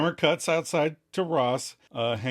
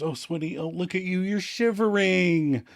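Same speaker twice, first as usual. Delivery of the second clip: tender fussing; 6 semitones higher than usual. Retold or imitated. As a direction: coo over a hurting friend. Monica comforting upset Ross.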